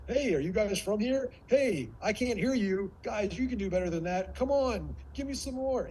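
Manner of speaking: high, cajoling crowd warm-up; hype-seeking speaker. Acted. Coach mimicking speakers who fish for rapport, hype-seeking. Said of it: "getting rapport with the audience only to sell them".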